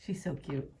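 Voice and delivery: quiet fond murmur; soft aside. Real, not acted. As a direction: say it softly, melting. Whispered aside about dog.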